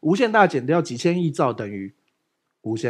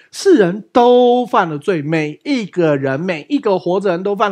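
preaching; 4 semitones higher, 6 dB louder, slower than usual. Real, not acted. Preaching the key point.

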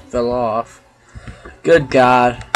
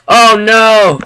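alarmed; 10 semitones higher, 11 dB louder than usual. Real, not acted. Fire going out in game.

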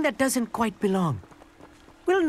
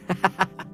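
short, easy chuckle; pleased laugh; 2 semitones lower than usual. Acted. Stargazer jokes about writing memoirs, pleased laugh. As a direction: laugh at your own remark.

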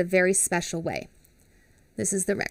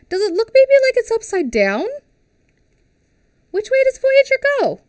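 playful high questions; 13 semitones higher, 10 dB louder than usual. Acted. Teacher asks kids, hammed.

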